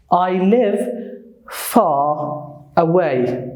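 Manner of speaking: FAR stretched into a long "fah", no R; British FAR with a long vowel and dropped R; British silent R. Shown as a demonstration. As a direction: slowly show FAR the British way, R left silent. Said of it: "in the British accent".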